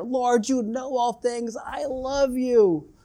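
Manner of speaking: high, slow, insistent imitated plea. Peter answers Jesus the third time, high insistent protest. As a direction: draw it out high, pleading to be believed. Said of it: "more and more getting louder".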